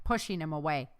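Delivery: ordinary delivery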